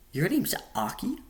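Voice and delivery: can't believe that's his name; disbelief. A narrator's reading. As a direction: pitch shooting up in disbelief. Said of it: "with disbelief in my voice".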